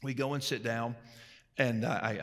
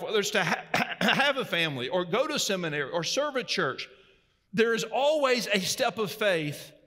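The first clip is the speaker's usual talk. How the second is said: raised, ringing preacher voice; preaching; 8 semitones higher, 4 dB louder than usual. Acted. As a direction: preach it out to the congregation. Preaching at full force.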